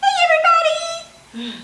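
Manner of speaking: put-on the puppet says hi to the kids; penguin puppet voice. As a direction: speak as the little penguin toy. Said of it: "we're gonna use my little penguin".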